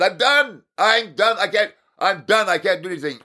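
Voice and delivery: high, pushed "I'm done" over and over; exasperated giving up. Retold or imitated. Retelling the failed video call, high exasperated surrender. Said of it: "I was just done".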